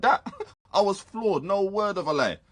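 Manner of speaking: half-laugh the words, still shocked; incredulous laughter. Acted. Player laughs at copper's words.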